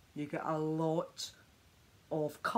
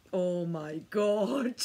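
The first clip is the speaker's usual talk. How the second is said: slow, louder awestruck gasp of words; amazed; 2 semitones higher, 5 dB louder, much slower than usual. Real, not acted. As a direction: stretch it out in real amazement. Amazed at her own result.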